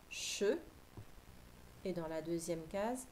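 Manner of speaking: ordinary talk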